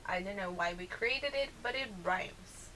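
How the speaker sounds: ordinary delivery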